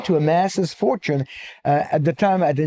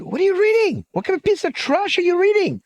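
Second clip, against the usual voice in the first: high-pitched, indignant disbelief in a joke; hammed-up shocked scolding, pitched way up; outraged friend.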